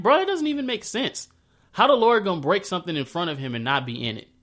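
can't believe what he's reading; incredulous. Retold or imitated. Speaker's own disbelief, self-quoted.

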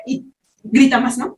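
ordinary talk

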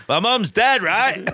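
high, loud indignant snap back; mock outraged yell, pitched high; loud retort. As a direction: fire it back loudly like a wounded heckler. Retold heckler shouting back.

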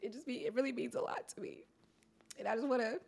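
quiet, voice breaking with real tears; fighting back tears as she speaks; choking up. Real, not acted. Guest thanking the host tearfully, voice breaking softly. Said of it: "Like, I'm gonna get emotional".

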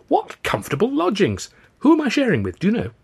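put-on high-pitched, delighted young man; beaming. A narrator's reading. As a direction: marvel at your good luck, pitch up. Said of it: "he beamed radiantly".

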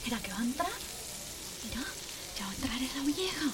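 soft, tense murmuring; quiet, wary suspense; hushed anticipation. Acted. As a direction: wonder under the breath what comes next. Anxiously awaiting the old woman.

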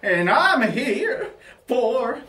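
put-on slow, fond character voice for an elderly married man; Barry Ovaltine. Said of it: "I'm Barry Ovaltine".

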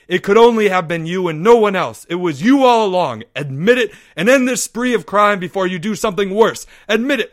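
high, loud, relentless accusation; commanding. Acted. Leo pressing Porge to confess, loud command. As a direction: bear down and demand it. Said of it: "Leo commanded".

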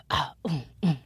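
ordinary talk

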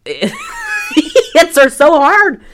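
high, loud, slow laughing squeal; real laughing shout. Real, not acted. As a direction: crack up while shouting the words. Cracks up retelling the hit.

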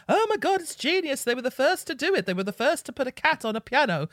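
high, loud hammed-up fan raving; mock gushing fan. Mocking fans who praise the band.

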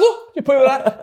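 laughing mock challenge; impression of the guest. Guest mocking the host's football career, slow and laughing. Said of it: "his impression".

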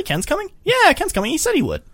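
high squeaky hammed-up reply; mock squeaky voice. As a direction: squeak it out like a giddy kid. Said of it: "He's like".

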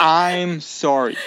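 slow, drawn-out, insincere "I'm sorry" with a laugh; mock apology. Shown as a demonstration. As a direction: drag out the "I'm sorry" like a hollow, fake apology. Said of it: "That's an extraordinarily selfish word to say".

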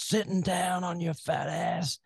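high, loud hammed-up tough-guy rant; mock drill sergeant insult; mock Goggins. Quoting Goggins birthday card.

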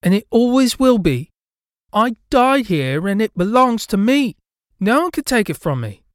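put-on high, very loud little-girl shout; ghost girl's yell. A narrator's reading. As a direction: claim it at full volume. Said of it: "her voice louder than should have been possible".

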